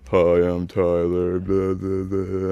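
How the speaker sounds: mocking classmates' impression of a monotone speaker; Tyler. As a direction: drone it in a put-on dopey voice, on one note. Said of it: "do, like, impressions and, like, make fun of me".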